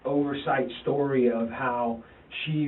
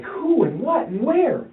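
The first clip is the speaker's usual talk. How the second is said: much higher, slow, disbelieving; stunned disbelief; 12 semitones higher, 7 dB louder, slower than usual. Retold or imitated. Father to his son after shocking news, stunned and much higher. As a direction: ask it reeling from the shock, slowly.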